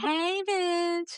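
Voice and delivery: high, sing-song hey bitch; lightly sweet hey bitch; friendly greeting. Retold or imitated. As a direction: say it like an affectionate hello. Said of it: "But I'm really meaning like".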